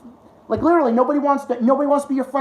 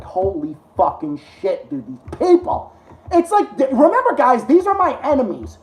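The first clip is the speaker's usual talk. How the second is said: ordinary delivery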